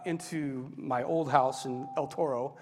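ordinary talk

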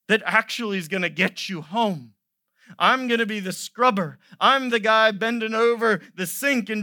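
loud, high, driving sermon voice; full-force preaching. Acted. Pastor voicing Jesus to the congregation, loud preaching. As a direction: preach it out to the back row.

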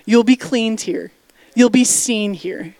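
ordinary delivery

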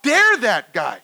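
high, slow indignant cry; worked-up outrage. Acted. Listeners worked up at the servant, slow high outrage. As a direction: stretch it out in loud outrage. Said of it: "getting all worked up at this point".

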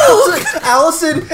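high laughing shout of a name. Real, not acted. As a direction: call her name out, cracking up. Calling out to laughing friend.

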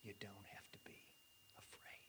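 whisper. Real, not acted. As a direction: barely breathe the words. Whispered climax of reassurance.

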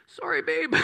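high, playful apology to his wife; a sheepish sorry tossed to babe; mock apology. Real, not acted. Pastor apologizing to wife on Facebook, sheepish high voice.

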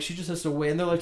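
normal talking voice

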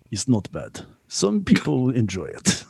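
deadpan imitation with a Russian accent; rough Russian accent. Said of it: "a really good Russian accent".

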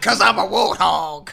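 slow, loud cartoon warthog. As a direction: ham it up as the warthog. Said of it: "voicing the movie warthog".

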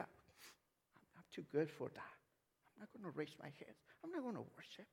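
soft, dismissive mimicry; mock reluctance to worship. Preacher imitating a proud churchgoer refusing worship, softly dismissive. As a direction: mimic someone too good to join in, under the breath.

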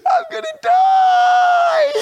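very high, slow, loud laughing; laughing shout. Retold or imitated. Man overcome with laughter in heaven, shouting slow. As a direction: gasp it out, dying with laughter.

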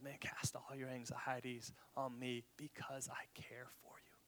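soft, hushed and caring; tender whisper. Retold or imitated. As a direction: whisper it gently like a comforting friend. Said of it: "tender, caring Jesus".

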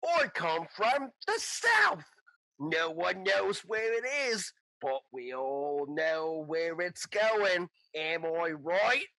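slow, drawn-out hammed-up accent; bad Rune imitation. Kobold head puppet explaining its origins, slow badly imitated accent. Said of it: "it's a really bad imitation of Rune".

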